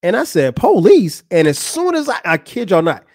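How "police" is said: surprised echo. Retold or imitated. Echoing the word in disbelief.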